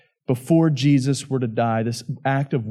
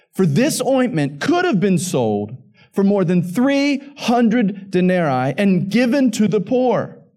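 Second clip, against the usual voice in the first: indignant objection read aloud, rising higher as it goes; 6 semitones higher than usual. A narrator's reading. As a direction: start slow, build into high-pitched protest. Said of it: "said to themselves indignantly".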